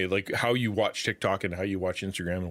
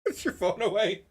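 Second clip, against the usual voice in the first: high-pitched, laughing through the words; 9 semitones higher than usual. Real, not acted. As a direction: crack up while pleading, voice high. Laughing at the influencer clip.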